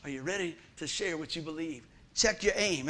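ordinary talk

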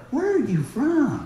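high, slow, stretched-out question; puzzled strangers. Retold or imitated. Strangers asking where he's from, high drawn-out puzzlement. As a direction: imitate a stranger puzzled by how he talks. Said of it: "go somewhere and they go".

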